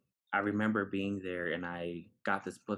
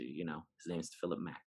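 ordinary talk, nothing marked

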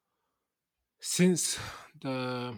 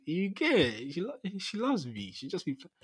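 excited; 7 semitones higher, 7 dB louder than usual. Real, not acted. Mum's love, excited high voice.

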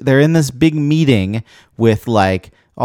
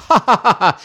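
mock villain laugh; an angry, aggressive cackle; evil chuckle; 10 semitones higher than usual.

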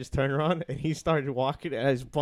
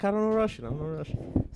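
mock Russian accent imitation; 8 semitones higher than usual. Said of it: "You're like".

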